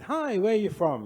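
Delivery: high, loud friendly hi; cheerful janitor greeting. Retold or imitated. Janitor greets the next person walking in, high and eager.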